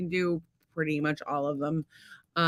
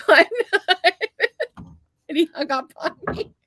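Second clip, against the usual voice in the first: high-pitched, squealing through real laughter; words swallowed by a real laughing fit; laughing helplessly; 11 semitones higher, 5 dB louder than usual. Real, not acted. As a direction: crack up while telling it, barely getting the words out. Cracking up over own story.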